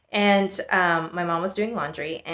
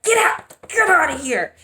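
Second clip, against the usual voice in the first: put-on telling the baby off; 9 semitones higher, 4 dB louder than usual. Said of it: "hit my belly and be like".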